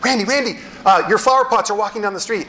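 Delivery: high-pitched imitated neighbor; neighbor calling. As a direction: pitch it up, reporting urgent news. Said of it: "got a call from our neighbor".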